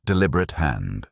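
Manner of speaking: plain reading voice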